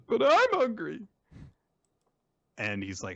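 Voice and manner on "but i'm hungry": put-on much higher, louder childish whine; a hungry boy whining to samurai; whiny kid. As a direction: complain like a hungry little kid. Child Yamato complaining.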